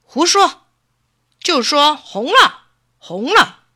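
high, loud, scolding order; angry fox. A narrator's reading. Fox makes the rabbit say its eyes are red, angrily. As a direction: snap at a useless pupil. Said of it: "狐狸生气地说".

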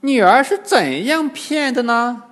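high-pitched mock astonishment; feigned surprise. A narrator's reading. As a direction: ask as an old demon pretending amazement. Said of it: "金蝉老妖假装吃惊". (假装吃惊，高声发问)

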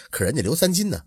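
ordinary delivery

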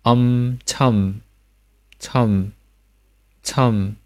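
"참" said as chum, with the open o-like vowel of 처; isolated careful syllables "엄", "참", falling; final m demonstration. Shown as a demonstration. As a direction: hum the closed-lip m ending "엄" and "참". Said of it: "操加收音".

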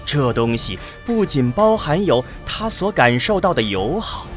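ordinary talk

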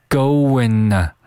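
"going" run into "to" with no t, like "go-win-na"; t dropped. Shown as a demonstration. Teacher builds up the short form, "going" said as "goenna" without t. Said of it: "会把这个 t这个音省略".

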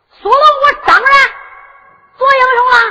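high, ringing shouted answer; emphatic declaration. Acted. Answering the dare to be a hero, proud shout. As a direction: declare it at full voice.

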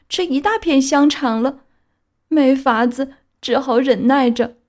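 high, resigned little donkey voice; donkey's inner voice. A narrator's reading. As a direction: sigh it to himself. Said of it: "他心里说". (皮诺乔无奈自语)

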